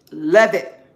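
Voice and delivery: belted out; loud love it. Real, not acted. Gushing about her rose, loud love it. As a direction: shout it with glee.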